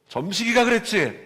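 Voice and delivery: high, loud indignant demand; mother raising her voice, hunting the culprit; mother's angry demand. Retold or imitated. Mother demanding who hurt her son, high and loud. Mother furious over son's scratch.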